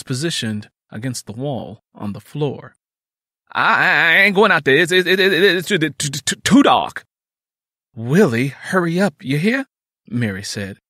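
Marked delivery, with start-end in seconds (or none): 3.5-7.0 loud, high stuttering refusal; scared stammer. A narrator's reading. Brother refuses to go out in the dark, stammering scared. 7.9-9.7 louder, higher nagging command; hurrying him. A narrator's reading. Sister sends her brother off, insistent.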